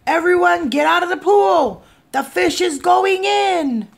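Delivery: high, loud warning shout; fireman's yell. A narrator's reading. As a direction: yell to clear everyone out. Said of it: "The fireman yelled".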